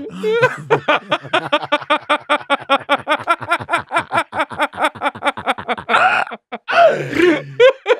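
long high howling laughter; helpless high laughter. Real, not acted. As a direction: crack up and howl. Cracking up at own story.

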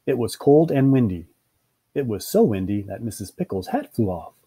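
ordinary delivery

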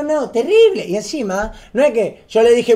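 ordinary talk

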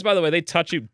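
normal talking voice

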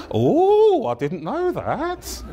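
high, drawn-out mock surprise; hammed-up female "oh, really" voice; woman neighbour. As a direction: swoop up high, then stretch it out. Woman neighbour reacts to his challenge.

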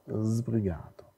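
„Sbrigato“ slow and soft, long A on -ga-; soft, slow echo of the model word; softly repeated model. Shown as a demonstration. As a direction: repeat „Sbrigato“ gently and slowly. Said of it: "der betonte Vokal A ist gelenkt".

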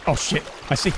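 usual speaking voice

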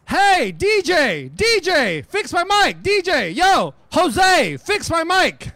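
slow, drawn-out yell across the room; calling out. Retold or imitated. Singer yelling at the DJ over the crowd, loud mock call.

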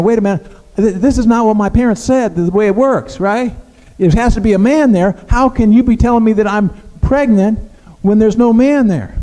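disbelieving protest. Retold or imitated. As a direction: push back like a confused teen.

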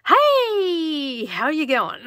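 high, loud, drawn-out mock greeting; loud Aussie greeting. Aussie YouTuber startling whoever clicks on, hammed-up loud greeting. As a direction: blast out an over-the-top hello. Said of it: "this Aussie chick is like".

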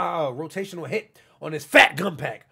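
ordinary delivery

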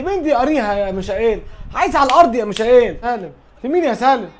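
usual speaking voice

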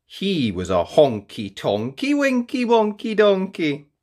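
ordinary delivery, nothing marked

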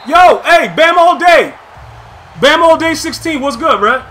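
real loud call-out. Real, not acted. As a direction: shout his gamertag to get his attention. Calling out to opponent.

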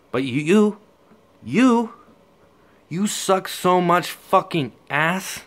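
you, you, YOU climbing to a loud high yell, then a slow drawn-out insult; mounting yell. Acted. Taunting a rival rapper, rising to a yell. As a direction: build the repeated you into a yell.

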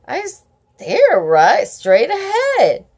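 plain reading voice

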